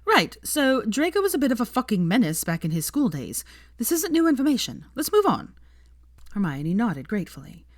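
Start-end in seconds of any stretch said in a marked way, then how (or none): 0.1-5.5 loud, rising and higher; cutting the tension by pushing the group on, loudly. A narrator's reading. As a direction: brush it off loudly. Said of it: "she said loudly".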